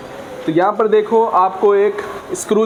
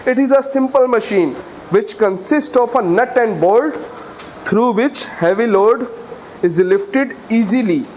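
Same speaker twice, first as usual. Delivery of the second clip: very slow, word-by-word dictation; slow textbook dictation; dictation pace; 3 semitones higher, much slower than usual. Acted. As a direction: read the definition out so students can write it down.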